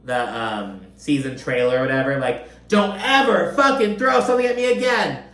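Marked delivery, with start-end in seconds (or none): 2.7-5.2 high, emphatic mock yell; Lisa's retold yelling. Host re-enacting the trailer clip of an upset castmate, yelling. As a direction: punch each word in an imitated outburst. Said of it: "yelling that".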